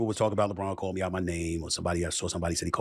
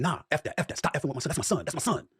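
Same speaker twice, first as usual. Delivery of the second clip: higher, heated retelling of LeBron; a touch of LeBron's fury, pitched up; mock furious LeBron; 8 semitones higher, much faster than usual. Host acting out LeBron's anger at him, higher and heated. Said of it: "I said".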